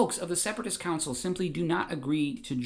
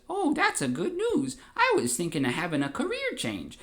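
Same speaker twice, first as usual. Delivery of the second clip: Jar Jar voice; 11 semitones higher than usual. Retold or imitated.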